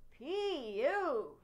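put-on boy at dinner smelling garbage, disgusted. A narrator's reading. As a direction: groan at the stink. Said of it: "Never mind the sound effects".

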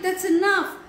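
high, exasperated shout; shouted command. A narrator's reading. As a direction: call it out to end it. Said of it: "he shouted".

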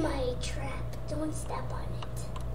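soft whispered warning. Real, not acted. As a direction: whisper it as a secret. Warning in hush.

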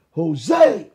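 booming call of his name; a thunderous voice calling Jose; thundering call. Retold or imitated. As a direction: call out like a voice from above. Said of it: "It was like thunder".

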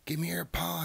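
high, loud urgent call; urgent rescuer. A narrator's reading. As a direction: call out to pull a friend to safety. Ratty urgently rescues Mouse.

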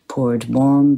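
usual speaking voice

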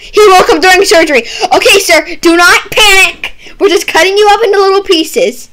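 mock panicked shout. Doctor shouting at patient who woke during surgery. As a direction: shout calm-down orders in a hammed-up panic.

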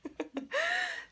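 sudden high-pitched laugh; high burst of laughter. Real, not acted. Laughs at own sarcasm.

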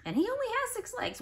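excited high squeal. Acted. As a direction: gasp it out to the class, amazed. Teacher excited about caterpillar.

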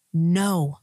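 acted-out emphatic command; loud no. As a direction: drop in one loud, level word. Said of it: "and a loud".

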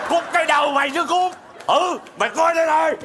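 angry outburst. Acted. Father rebuking daughter's excuse, angry shout. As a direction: explode at the cheeky excuse. Father angrily threatens to smash things.

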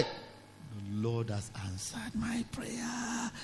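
slow, hushed whisper of wonder; drawn-out breathy amazement; awed whisper. Acted. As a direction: whisper it slowly, as if prayer is answered. Awed reaction to the knock.

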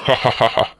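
slow, deliberate ha-ha-ha; mocking laugh. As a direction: chuckle softly in mock approval. Boss mocks Rakesh's devotion.

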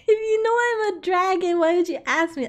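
high and loud, playfully indignant; mock offended. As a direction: act outraged that chat would ask a dragon. Mock offended dragon answering chat.

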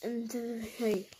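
ordinary delivery

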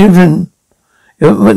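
ordinary delivery

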